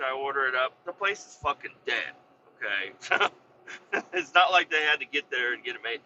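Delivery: ordinary talk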